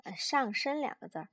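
ordinary delivery, nothing marked

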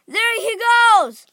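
high, loud, drawn-out shout. A narrator's reading. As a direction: onlooker calling out to the crowd. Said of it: "somebody shouted".

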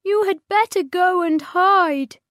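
Patty urging Cosmo to hide, high and sad; sadly. Acted. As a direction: send your friend away to safety. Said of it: "she said sadly".

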